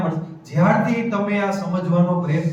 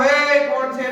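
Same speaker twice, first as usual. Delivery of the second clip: high, sharp mock alarm at a noise; startled shout; 7 semitones higher, 3 dB louder, much slower than usual. Mother imitating herself startled by a neighbour's noise, high alarmed call. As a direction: jump up and snap out who's there. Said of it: "એમ કરીને jump મારીને".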